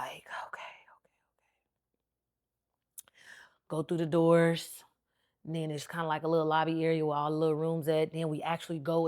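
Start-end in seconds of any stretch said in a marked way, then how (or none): 0.0-3.1 whispered okays, soft and tense; hushed nervous self-talk. Retold or imitated. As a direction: whisper to calm yourself down. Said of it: "I'm getting nervous".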